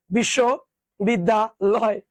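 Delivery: বিশ্ববিদ্যালয় in three measured chunks. Shown as a demonstration. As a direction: say it as bishsho, bidda, loy. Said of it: "একটু দ্রুত পড়ো".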